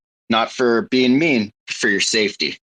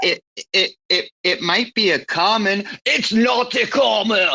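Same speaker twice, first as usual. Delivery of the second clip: put-on much higher shouted movie-star accent; hammed-up Arnie; Arnie impression; 10 semitones higher than usual. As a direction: shout it like Arnie denying a tumor. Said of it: "never do an Arnie impression".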